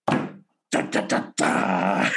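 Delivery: punchy mock guitar riff, da da; imitating heavy metal riff. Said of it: "all of a sudden it was like".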